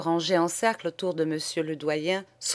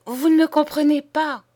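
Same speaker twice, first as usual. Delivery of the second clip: sharp interruption; 10 semitones higher, 7 dB louder than usual. Acted. Nun cutting off the ranting priest, sharp and loud. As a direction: cut in loud and high. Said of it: "interrompit sœur Angèle".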